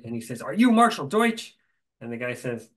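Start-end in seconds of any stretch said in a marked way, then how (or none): 0.4-1.5 high, loud, impatient question; agitated demand. Retold or imitated. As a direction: bark it at him, agitated. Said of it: "the head of the transition is all agitated".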